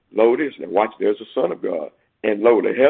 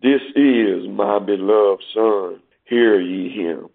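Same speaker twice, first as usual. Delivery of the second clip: slow, lower, solemn voice of God; voice from heaven; 4 semitones lower, slower than usual. Acted. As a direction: speak slowly as the heavenly Father. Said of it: "a voice from heaven".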